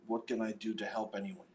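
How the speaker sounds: usual speaking voice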